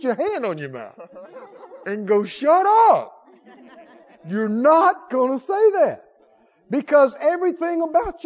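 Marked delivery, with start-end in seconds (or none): 2.2-6.0 mock self-rebuke.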